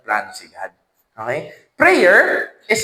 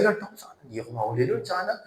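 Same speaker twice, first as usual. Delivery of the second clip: quick, softer frantic little prayer; nervous hushed prayer; 7 semitones lower, 13 dB quieter, much faster than usual. Retold or imitated. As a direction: pray under your breath, scared of getting caught. Praying driver fearing enforcer. (kinakabahang pabulong na dasal)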